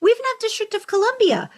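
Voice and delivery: rally the last two states; excited plea. Real, not acted. Host pleading with listeners from missing states, excited.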